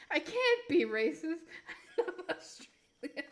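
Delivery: breathless laughing through the words; laughing protest. Real, not acted. Streamer insisting they love Australia, laughing helplessly. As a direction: protest while cracking up.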